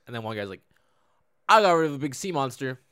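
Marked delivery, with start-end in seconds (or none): 1.5-2.8 louder, higher mock boast; playful acted brag; boastful one-upping. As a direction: top the last guy proudly. Voicing a one-upping braggart.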